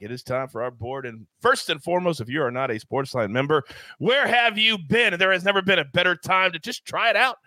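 usual speaking voice

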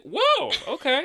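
high, drawn-out whoa; impressed surprise. Retold or imitated. Retells own reaction to scene.

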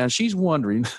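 ordinary talk, nothing marked

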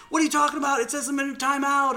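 very high-pitched retold bafflement; baffled reaction. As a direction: squeal it in mock disbelief. Acting out his baffled reply.

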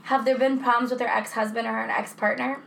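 ordinary talk

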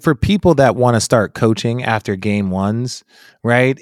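ordinary talk

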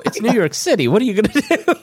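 high-pitched comic what-can-you-do; mock exclaiming. Answering the shocked church girl, high comic resignation.

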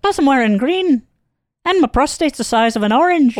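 high, odd character voice; squeaky joke character; high put-on voice. Bit after prostate joke.

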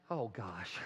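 low, soft letdown; deflated. Acted. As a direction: drop right down after the outburst. Deflating after the acted outburst.

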